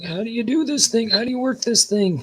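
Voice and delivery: high-pitched put-on grandpa voice; hammed-up old man fussing over a gadget; baffled grandpa. Grandpa confused by the TV remote, baffled put-on voice.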